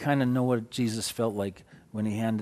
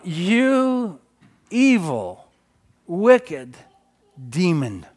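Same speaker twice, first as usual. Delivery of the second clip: high, loud rebuke of a demon; loud, high commanding rebuke; rebuking a demon; 6 semitones higher, 6 dB louder, much slower than usual. Retold or imitated.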